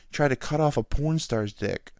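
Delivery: ordinary talk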